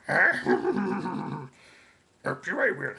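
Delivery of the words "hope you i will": lightly voiced Yoda; a hint of the little Jedi master; mild Yoda impression. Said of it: "the Yoda voice".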